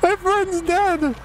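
high upset wail for a lost friend; distressed cry. Real, not acted. Teammate killed in game.

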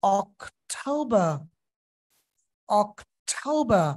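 slow অক্টোবর with টৌ vowel; British pronunciation. Shown as a demonstration. Teacher models British অক্টোবর slowly. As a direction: stretch অক্টোবর as ok-tau-ba. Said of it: "পরেরটায়ও".

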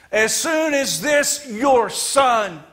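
high, loud, slow scorn on your son; contemptuous. Acted. Son disowning brother to father, high loud scorn. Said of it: "wouldn't even call him his brother".

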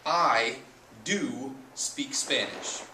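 "do" stressed and drawn out slowly; slow, stressed "do", teacher showing the hidden verb. Shown as a demonstration. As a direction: lean on "do" in "I do speak Spanish". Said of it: "what we're literally saying here is".

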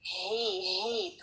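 soft whispered breathy ha exhales; breathy ha sound. Shown as a demonstration. Teacher demonstrates the airy ha sound, whispered. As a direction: push air out with no voice. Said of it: "ड्राउनी sound होती है".

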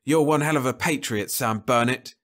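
scornful jab. Acted. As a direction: sneer it at him.

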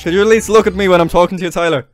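ordinary delivery

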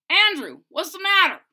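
friend's voice. Acted. Lewis asking Andrew what's wrong, concerned. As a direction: ask like a worried best friend.

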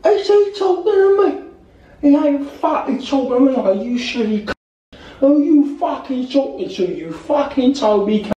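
high, drawn-out hooligan goading; hammed-up fan squaring up for a fight; mock football fan. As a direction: stretch out the threats in a silly high voice. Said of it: "football fans, they go".

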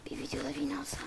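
hushed wish to himself; soft whispered aside. Real, not acted. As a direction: whisper it like a secret hope. Wishing aloud during a game.

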